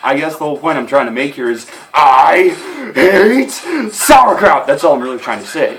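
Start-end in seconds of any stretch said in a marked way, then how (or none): 1.9-4.6 I, hate, sauerkraut each punched loud, with pauses; emphatic declaration. Acted. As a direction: pause between words, building to a loud "sauerkraut". Emphatic punchline after rambling.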